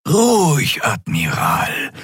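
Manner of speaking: slow, breathy alien murmur; nonhuman voice. A narrator's reading. Alien medic calming the admiral, a nonhuman murmur. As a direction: soothe him like something not human. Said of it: "nichtmenschliche Stimme".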